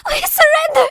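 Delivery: high, loud weeping cry; weeping plea. Acted. Defeated girl weeps her surrender to the enforcers. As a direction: beg through tears. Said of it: "Nenny wept".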